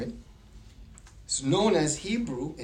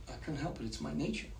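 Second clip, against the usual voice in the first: sheepish scorpion; 12 dB quieter, faster than usual. Acted. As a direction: shrug it off quietly as the scorpion. Scorpion excuses itself after sting.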